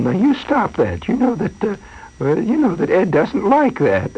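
imitating a lady gently scolding ants. Lady scolding ants on her sink.

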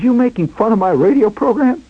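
high, mildly indignant challenge; accusing mother. Retold or imitated. Mother suspects son mocks her program, accusing.

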